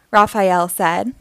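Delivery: usual speaking voice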